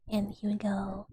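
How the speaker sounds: normal talking voice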